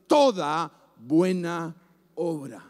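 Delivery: "toda buena obra" bajando de tono palabra por palabra; solemne y descendente. Real, not acted. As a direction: deja caer "obra", grave y suave, tras una pausa. Primera vez, baja al final. ("toda buena obra" solemne, cada vez más grave)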